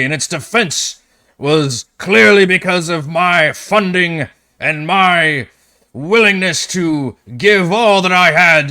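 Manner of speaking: loud, high, outraged shouting; furious outburst. Acted. Bakaris shouts at the party before the governor, furious. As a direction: roar back at the insult, slow and wounded.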